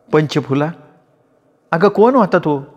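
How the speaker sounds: a hint of a simple-hearted lady; lady of the house. A narrator's reading. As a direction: ask with naive curiosity. Baisaheb asks.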